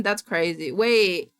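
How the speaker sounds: usual speaking voice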